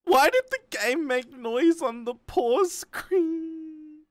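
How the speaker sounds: very high, shaky scared cry; real fright. Real, not acted. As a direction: shriek it, genuinely startled. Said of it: "that scared me".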